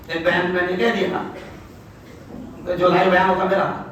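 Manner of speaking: usual speaking voice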